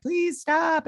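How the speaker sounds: high, pleading mock plea; pleading Molly. Molly begging Batman to stop the beating, high mock plea. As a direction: squeak it out like a frightened friend.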